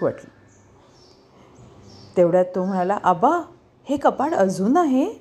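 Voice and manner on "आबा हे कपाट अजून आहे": put-on high, excited call to grandpa on finding the old cupboard; a thrilled cry of recognition, pitched high; excited shout. Grandson spotting his childhood cupboard calls grandpa, excited high shout. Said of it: "तो जोरात ओरडलाच".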